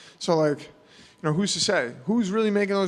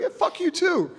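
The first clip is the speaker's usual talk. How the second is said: much higher, breezy "fuck you too"; mock comeback; 14 semitones higher than usual. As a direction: toss it back light and cocky, pitched way up. Imitating his own comeback.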